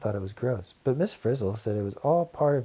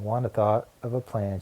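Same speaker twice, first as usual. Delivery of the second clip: ordinary delivery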